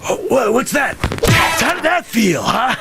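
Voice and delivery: high, shouted gloating; gloating taunt. Acted. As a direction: taunt him with glee. Taunting after the punch.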